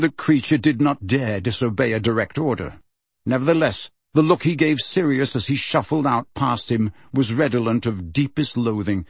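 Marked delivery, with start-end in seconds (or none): none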